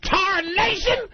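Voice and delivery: put-on drawn-out old-timer cry; old prospector. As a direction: yell it like a grizzled miner. Joking about old gold miner.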